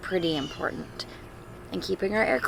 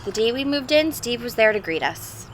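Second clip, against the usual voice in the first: put-on clear, louder reading-to-children voice; storybook read-aloud; 3 semitones higher, 6 dB louder than usual. Teacher reading a picture book to the class, storybook voice. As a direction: read it like a father's letter to his kids.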